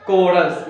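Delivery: कोरस with a hard k sound, not ch; model pronunciation. Shown as a demonstration. Teacher modelling the word for students, crisp k sound. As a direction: say कोरस as k-o-rus, clearly. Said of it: "तो उसको हमने कोरस पढ़ना है".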